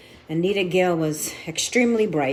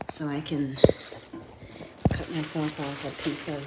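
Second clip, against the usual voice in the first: whispering; 3 semitones lower, 6 dB quieter than usual. Real, not acted. Muttering while cutting bread.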